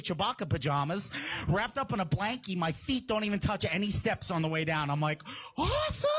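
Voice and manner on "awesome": high-pitched, gleeful holler; hammed-up childish excitement; thrilled kid yell. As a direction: cry it out like an overjoyed kid. Child racing downstairs to breakfast.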